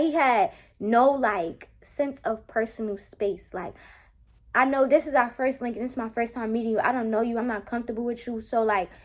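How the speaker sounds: ordinary delivery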